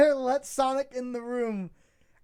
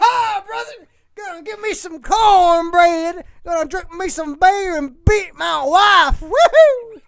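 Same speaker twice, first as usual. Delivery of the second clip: hillbilly voice; 3 semitones higher, 7 dB louder than usual. Acted. Playing Starfire as a whooping Southern hillbilly. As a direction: ham up a Southern yokel accent. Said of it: "you need to do the hillbilly voice".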